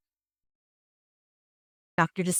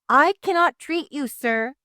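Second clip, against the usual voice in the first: higher, louder shout of refusal; 9 semitones higher, 6 dB louder than usual. A narrator's reading. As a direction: shout it up at him from the window. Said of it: "Doctor DeSoto shouted".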